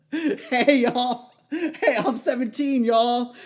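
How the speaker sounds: high, full-voiced holler to a crowd; drawn-out, high-pitched shout; yelling at crowd. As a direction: yell it as loud as you can. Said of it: "and I yelled".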